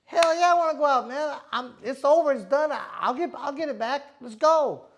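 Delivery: loud, high, fired-up reply; eager yes. Retold or imitated. Boxer telling his friend he wants to go out, loud and eager. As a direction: bounce back loud and eager.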